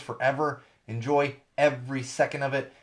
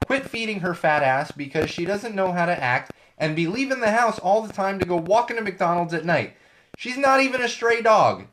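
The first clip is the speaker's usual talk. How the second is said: quoting an annoyed dog owner, higher and a bit louder; reading the owner's angry post; 6 semitones higher, 3 dB louder than usual. Retold or imitated. Podcaster quoting the dog owner's angry post, higher and louder. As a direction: read out the fed-up owner's rant with sass. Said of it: "And I quote".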